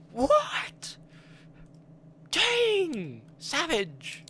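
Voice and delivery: very high, astonished cries; shocked squeal. Real, not acted. Streamer reacting to a character's reveal, very high shocked squeal.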